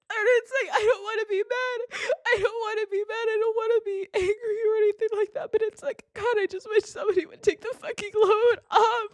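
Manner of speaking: tearful and high; crying. Real, not acted. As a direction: speak through sobs. Crying, asking for help.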